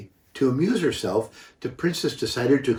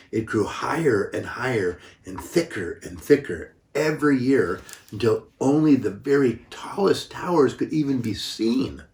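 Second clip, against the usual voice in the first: plain reading voice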